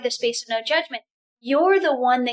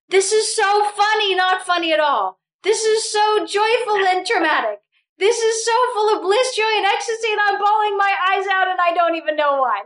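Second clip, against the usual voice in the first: much higher, gushing half-laughing, half-crying; overwhelmed mock exclaim; 7 semitones higher than usual. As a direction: burst out in blissful tearful wonder. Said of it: "It's like".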